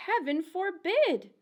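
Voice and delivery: ordinary delivery, nothing marked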